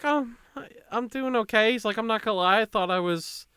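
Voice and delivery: high-pitched stoned friend. Retold or imitated.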